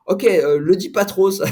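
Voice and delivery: plus aigu et plus fort, complice et amusé; imitation du journaliste. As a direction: glisser la consigne en rigolant, voix du collègue. Journalist's reply retold, joking. (journaliste qui conseille de le taire, voix imitée plus aiguë)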